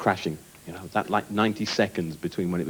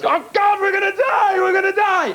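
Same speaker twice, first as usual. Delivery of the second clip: high, loud mock panic shouts; panicking scream; 5 dB louder than usual. Mock panic of passengers on a crashing plane, screaming. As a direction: shriek it like passengers sure they'll die. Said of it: "Everybody else".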